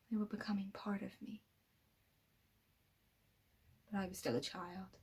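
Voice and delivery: very soft, breath-heavy half-whisper; hushed confession. Acted. Villain telling Eleven of lives he took, hushed. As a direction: confide it almost under the breath. Villain confiding his killings.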